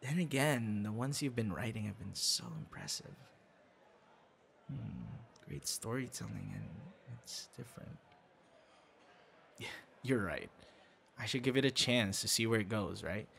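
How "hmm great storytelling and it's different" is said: barely voiced, breathy awe; hushed admiration. Praising the writer's stories in a hushed whisper. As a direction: breathe it out, almost to herself. Murmured praise of her writing.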